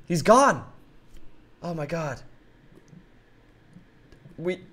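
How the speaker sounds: normal talking voice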